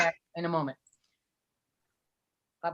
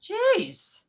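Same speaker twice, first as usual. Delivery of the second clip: surprised; 12 semitones higher than usual. Real, not acted. Reacting to silent film news.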